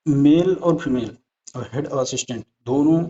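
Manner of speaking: ordinary delivery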